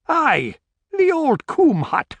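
high-pitched gamekeeper; gamekeeper's character voice. Acted.

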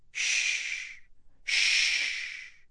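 soft hushing whisper; soothing whisper. A narrator's reading. Wife hushing her weeping husband, whispered. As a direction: breathe the shh to calm him. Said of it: "she murmured".

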